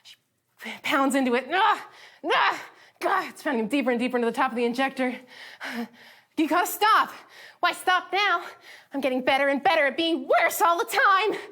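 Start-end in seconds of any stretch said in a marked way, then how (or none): 1.5-3.3 high, loud pained yelps; pained cries. Retold or imitated. Steven pounded into the injector. 6.4-11.4 high, loud, unhinged rant; manic rant. Retold or imitated. Spinel ranting at Steven while she pounds him.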